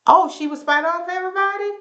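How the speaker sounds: high, quick mock disbelief; shady high-pitched sarcastic quote; sceptical Portia. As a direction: toss it off as a fake-innocent question. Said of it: "Portia was like".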